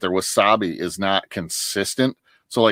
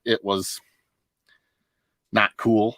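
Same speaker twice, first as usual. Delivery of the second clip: ordinary delivery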